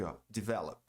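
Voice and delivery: normal talking voice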